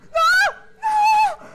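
high panicked screams of no; terrified screaming. Real, not acted. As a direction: scream in real fright. Jump scare screaming.

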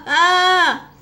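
high startled ah; startled yelp. Acted. Kid startled by his game character jumping, yelp. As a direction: yelp as if caught off guard.

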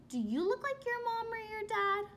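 usual speaking voice

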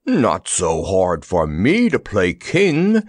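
slow, breathless lion voice; a slow, winded wheeze in the lion's own voice; wheezing lion. Acted. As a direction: squeeze the words out after a bear hug. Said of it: "he wheezed".

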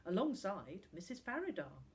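usual speaking voice

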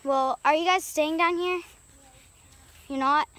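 ordinary talk, nothing marked

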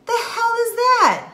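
high, loud indignant outburst; outraged. Real, not acted. Mom scoffing at doctors' one-size advice, high and loud. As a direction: blurt it out, scandalized.

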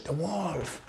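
soft, drawn-out "wolf"; secretive, stretched little warning; children's hushed warning. Acted. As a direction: pass it on softly like children among themselves. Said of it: "todos los niños se hacen entre ellos".

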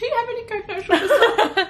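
high-pitched mock yell with laughter; mock shouting to cabin crew; retold yell. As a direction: call out in a high voice, half laughing.

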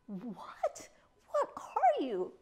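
nervous bird voice rising to a squawk; quavering. A narrator's reading. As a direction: quaver it slowly in fear. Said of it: "quavered the pigeon".

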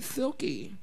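soft put-on impression of a friend; Kylie voice. Imitating a friend calling Silky, soft put-on voice. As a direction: say it quietly in an imitated voice. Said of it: "Girl, that's my Kylie voice".

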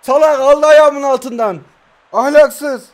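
loud, high furious protest; furious yelling after conceding a goal; outraged shout. Real, not acted. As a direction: yell at the cheap goal.

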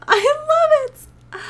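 high, laughing delight. Real, not acted. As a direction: squeal it with a laugh. Laughing at the Sim's reaction.

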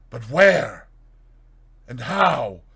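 crying out. Acted. Fetz demanding where the body came from: loud alarmed questioning. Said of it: "cried the other".

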